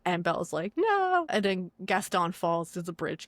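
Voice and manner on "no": anguished no. Retold or imitated. Belle sees Beast shot.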